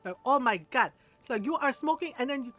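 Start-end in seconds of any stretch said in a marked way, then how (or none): 0.2-0.9 louder imitated Filipino accent; dismayed Filipino mom impression; Filipino mother. Filipino mom smelling smoke, accented dismay. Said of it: "our little tiny Filipino mother". 1.4-2.1 put-on higher Filipino-accented accusation; Filipino mother. Filipino mom sure her son smoked, accented accusation. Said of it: "our little tiny Filipino mother".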